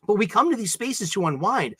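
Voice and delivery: ordinary talk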